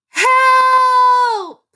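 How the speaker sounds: loud, high, drawn-out cry of HELP; exclamation with feeling. Shown as a demonstration. Teacher demonstrating an exclamation point, a high shouted exclamation. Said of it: "It was said with feeling".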